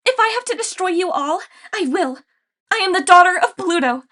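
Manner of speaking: loud, high, defiant declaration; defiant shout. Acted. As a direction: shout it so friends hear. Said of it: "She raised her voice again".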